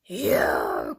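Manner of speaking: loud, very low roar; cried out. A narrator's reading. Fox leaping at the birds, roaring. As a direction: pounce on the word with a growling shout. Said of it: "cried Foxy Loxy".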